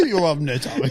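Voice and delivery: ordinary delivery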